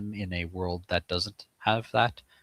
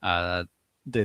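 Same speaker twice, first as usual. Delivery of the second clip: ordinary delivery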